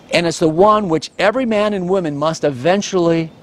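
ordinary delivery

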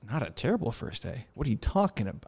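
hushed, offended private reaction, retold; incredulous inner thought. Pilot privately reacts to lukewarm debrief, quietly incredulous. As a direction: softly echo it in disbelief. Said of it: "this is what I'm thinking".

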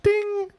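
high-pitched sung "ding"; Alexa chime. Pretend. Alexa waking to answer a parent, high chime. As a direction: imitate a device's alert tone. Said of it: "I'm trying to do Alexa".

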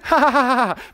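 scornful acted ha-ha-ha; mocking laugh. David laughing at Goliath's threat, mocking.

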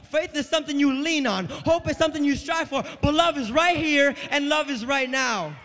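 loud, high sermon climax; full-force preaching. Real, not acted. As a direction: build to a shouted proclamation.

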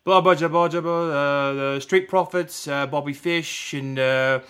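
lightly imitating a British commentator mumbling his lines, slow; a hint of British accent, reading lines under his breath; mock British accent. Nigel studying his lines with the woman, lightly British-accented mumble. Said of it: "I notice he has an accent".

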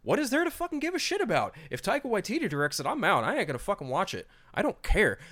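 high-pitched, indignant dismissal; worked-up, fed-up ranting; exasperated rant. Real, not acted. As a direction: protest it with the voice riding high. Exasperated rant, not caring about sequel.

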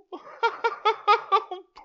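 squealing giggle; high-pitched laugh. Real, not acted. Laughing at Pooh photo.

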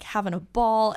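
normal talking voice

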